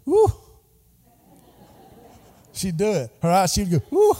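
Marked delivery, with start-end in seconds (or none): 0.1-0.3 imitating a delighted little "woo"; a short excited hoot, done in fun; granny's excited woo. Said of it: "and she'd go". 3.9-4.2 imitating a delighted little "woo"; a short excited hoot, done in fun; granny's excited woo. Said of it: "like this right here".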